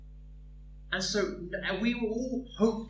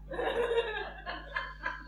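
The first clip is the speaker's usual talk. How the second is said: burst of laughter; 6 dB quieter than usual. Real, not acted. Laugh after the joke.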